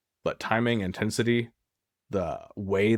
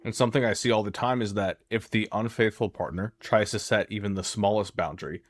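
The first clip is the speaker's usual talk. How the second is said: ordinary delivery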